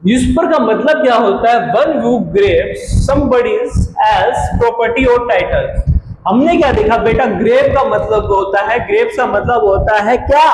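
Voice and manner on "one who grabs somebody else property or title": slow, word by word for the class; slow dictation. Shown as a demonstration. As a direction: spell it out slowly so students can write. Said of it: "teacher dictates English definition".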